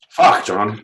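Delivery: usual speaking voice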